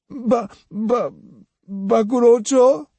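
high halting stammer; stammering boy. A narrator's reading. Lost boy stammering his home to Otsu, halting. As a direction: trip over the name, still upset.

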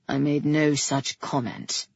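low, guarded denial; restrained brother. A narrator's reading. Brother denying disapproval to the king, restrained. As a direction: deny it low and evenly.

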